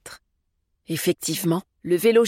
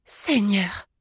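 small, shocked gasp of a word; understated alarm; startled exclamation; 2 semitones higher than usual. A narrator's reading. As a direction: exclaim in dismay without raising volume. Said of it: "s'exclama Anna".

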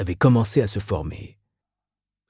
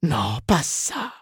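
slow, drawn-out sympathy; sincere compassion; 6 semitones higher, much slower than usual. A narrator's reading. Miss Inez pities Mrs Reilly's arthritis, compassionate. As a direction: stretch it out in high-voiced concern. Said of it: "avec une compassion sincère".

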